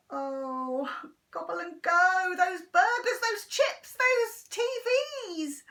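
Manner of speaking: Henry dreaming of the restaurant; longing. Acted. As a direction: pine for the treats.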